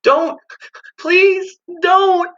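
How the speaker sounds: very high, loud frightened begging; high panicked child's plea; terrified pleading. Acted. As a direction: plead like a terrified boy running. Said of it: "Wendell was sobbing as he ran".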